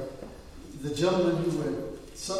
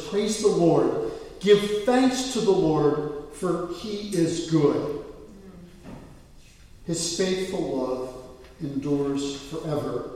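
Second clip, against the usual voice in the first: solemn scripture reading; 4 dB louder, slower than usual. Acted.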